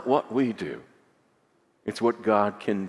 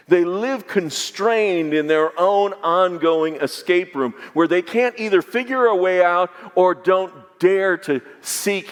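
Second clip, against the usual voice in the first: raised, driving preacher voice; fervent preaching; 8 semitones higher, 5 dB louder than usual. Acted. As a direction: build the sermon to a passionate peak. Preaching builds to full force.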